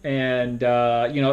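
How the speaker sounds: normal talking voice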